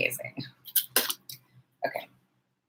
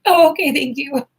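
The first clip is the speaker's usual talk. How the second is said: chirpy quoted reply; 9 semitones higher, 5 dB louder than usual. Retold or imitated. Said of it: "So I was like".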